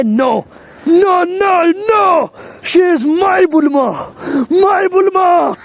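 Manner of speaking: drawn-out cries of denial, climbing high; shocked outcry. Acted. Vegeta seeing Bulma destroyed, shocked anguished outcry. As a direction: cry out in disbelief, stretching the words. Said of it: "gets very shocked and say".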